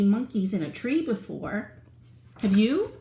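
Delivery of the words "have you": plain reading voice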